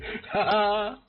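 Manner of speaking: short high burst of laughter; giddy gamer laugh at the win; high excited laugh. Real, not acted. Laughs at one-hit kill.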